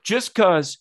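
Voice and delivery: high, emphatic imitated telling-off; retold scolding. Lady shushing a mailman for discouraging a dream, mock scolding.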